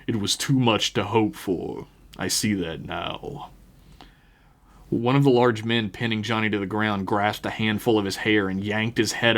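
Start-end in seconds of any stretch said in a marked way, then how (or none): 0.1-3.5 lightly voiced guttural preacher, slowing at the end; a touch of deep, guttural menace. A narrator's reading. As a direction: let the last words sink lower, slowly. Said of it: "A deep guttural voice cut the silence".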